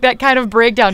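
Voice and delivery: normal talking voice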